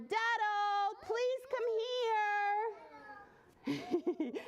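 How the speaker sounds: high, drawn-out call; hollering. A narrator's reading. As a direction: call out to someone far off. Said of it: "lined up and hollered".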